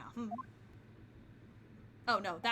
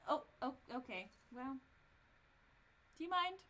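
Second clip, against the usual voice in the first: ordinary talk, nothing marked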